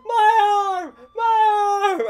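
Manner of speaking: high, loud crying wail; retold pained wailing. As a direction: howl it like a kid in agony. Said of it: "me going".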